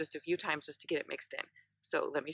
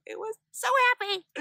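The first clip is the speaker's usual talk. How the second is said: high, loud re-enacted shriek of joy; happy shriek; 9 dB louder than usual. Retelling how the new blade arrived, a loud happy shriek. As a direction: shriek it like you just got the blade back. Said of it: "I shrieked like a girl".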